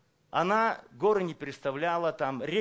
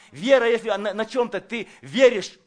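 loud preaching; 5 semitones higher, 10 dB louder than usual. Real, not acted. Preacher at full force.

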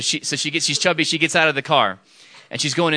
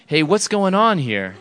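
higher, slow mock confrontation; imitated boyfriend; 6 semitones higher, 4 dB louder, slower than usual. Boyfriend interrupting the hug, mock suspicious. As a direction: play the boyfriend butting in, drawn out. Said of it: "her boyfriend gets out of the car".

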